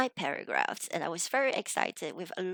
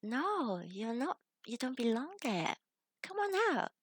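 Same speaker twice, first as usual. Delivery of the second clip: higher-pitched, imitating a teacher calling someone out; Jennifer's coaxing call; 3 semitones higher than usual. Teacher calling her student out of the rubbish dump, higher coaxing imitation. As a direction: voice a rescuer coaxing, pitch climbing at the end. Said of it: "Jennifer, came and shined a torch down and said".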